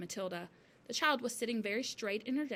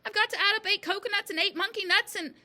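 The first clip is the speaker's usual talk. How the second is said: loud, high, flustered pleading; flustered cry; 11 semitones higher, 7 dB louder than usual. A narrator's reading. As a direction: plead loudly for time to add it up. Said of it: "he cried".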